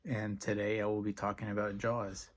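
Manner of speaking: ordinary talk, nothing marked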